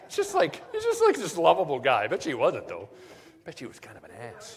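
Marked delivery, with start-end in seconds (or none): 0.1-2.0 laughing. Real, not acted. Laughing while describing him.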